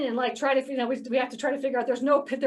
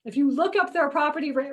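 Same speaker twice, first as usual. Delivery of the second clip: ordinary talk, nothing marked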